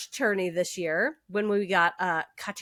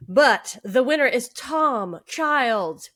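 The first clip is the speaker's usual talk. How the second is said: normal talking voice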